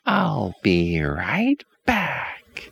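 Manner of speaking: put-on high, slow, sugary old woman; sweet witch voice. A narrator's reading. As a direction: coax them in a sickly sweet voice. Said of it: "the woman says".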